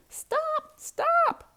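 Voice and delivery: high loud squawking stop; crying out. Acted. The first hen cries out at the pigs' messy painting. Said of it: "cried the first hen".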